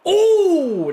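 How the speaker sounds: sudden high, loud oh; surprised shout. Real, not acted. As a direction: yelp at a near miss. Near miss on a pass.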